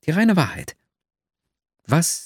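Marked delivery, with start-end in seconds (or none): none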